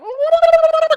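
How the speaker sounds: loud, high warbling babble; imitated flopping noise. Imitating the witch flopping about, high warbling noise. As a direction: wobble a nonsense noise loudly.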